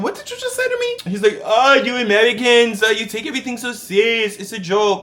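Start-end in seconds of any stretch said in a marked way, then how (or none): 0.0-1.1 much higher, shocked what did you just say; incredulous, voice shooting up; outraged disbelief. Retold or imitated. To the agent after a crude joke, outraged disbelief. 1.4-5.0 high, loud, brushing-it-off foreign agent; mock the agent laughing it off; dismissive agent. Agent waving off his offence, loud and dismissive.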